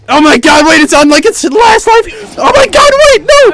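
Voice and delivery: high panicked shouting. Real, not acted. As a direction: yell in sudden panic. Dragon near death panic.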